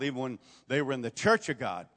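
ordinary talk, nothing marked

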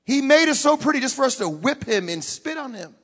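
ordinary delivery

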